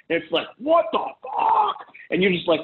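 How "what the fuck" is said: slow, very high-pitched strained outburst; mock rage. Triggered man losing it in an argument, acted rage. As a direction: draw it out in exaggerated boiling fury. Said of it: "all the rage and stuff comes out of you".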